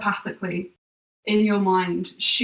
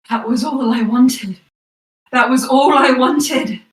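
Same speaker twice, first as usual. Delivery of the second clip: pained outcry, higher and louder, building; screaming in pain; 5 semitones higher, 9 dB louder than usual. A narrator's reading. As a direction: cry it out through the screams, louder the second time. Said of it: "through the screams, you hear".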